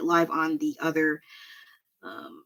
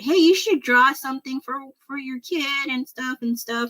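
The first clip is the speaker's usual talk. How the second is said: lightly voiced husband, pitched higher; husband imitation; 8 semitones higher than usual. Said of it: "my husband said".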